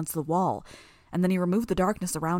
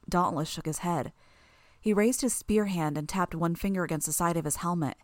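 usual speaking voice

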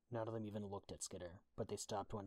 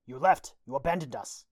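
loud, high accusing shout; raised voice; 6 semitones higher, 18 dB louder than usual. A narrator's reading. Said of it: "Yan raised her voice".

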